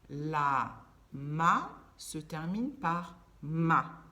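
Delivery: "ma" stressed loud and slow at the end; slow emphatic contrast. Shown as a demonstration. Teacher contrasting endings, loud and slow. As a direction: spell out the ending "ma" slowly. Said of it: "se termine par tron".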